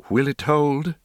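a touch of nervous doubt; timid hushed maid. Acted. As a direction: ask it timidly, a little hushed. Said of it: "she murmured nervously".

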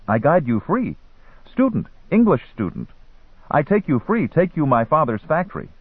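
high-pitched pleading hustler; young guide's broken English. A narrator's reading. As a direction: pitch a tour like a street boy.

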